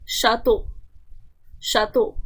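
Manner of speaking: शातो said the French way, sha-to; French pronunciation. Shown as a demonstration. Teacher to students, showing how to say शातो in French. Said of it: "इसका pronunciation देखो".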